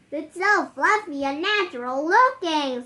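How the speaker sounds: high, loud admiring gush; admiring elf. Acted. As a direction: marvel at the beard. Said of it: "said the elf".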